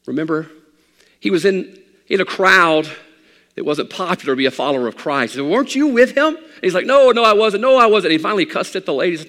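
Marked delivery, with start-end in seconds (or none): 1.2-9.1 loud, high, driving sermon climb; preaching at full force. Acted. Pastor preaching to his congregation, full force. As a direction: build it like a revival preacher.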